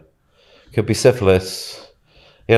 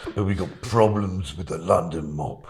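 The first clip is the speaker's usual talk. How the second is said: put-on gruff Peaky Blinders Birmingham accent; Arthur impression; slower than usual. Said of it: "Can you do an Arthur impression from Peaky Blinders".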